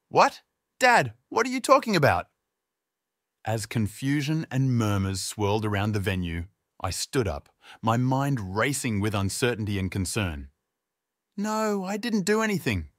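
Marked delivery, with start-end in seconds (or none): 0.1-2.3 high, loud, bewildered; stammering shock. A narrator's reading. 11.4-12.9 protesting. A narrator's reading. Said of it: "I protested".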